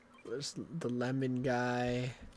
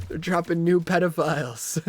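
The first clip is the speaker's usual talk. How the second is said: laughing; 5 semitones higher, 9 dB louder than usual. Real, not acted. Laughing at own joke.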